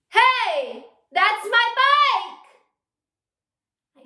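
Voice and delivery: very loud, high, dragged-out furious shout; angry yell. Shown as a demonstration. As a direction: yell at the thief riding off on your bike. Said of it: "we're angry now".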